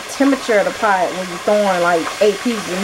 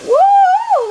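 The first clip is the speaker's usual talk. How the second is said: excited woo; 7 dB louder than usual. Real, not acted. Excited at frying chicken.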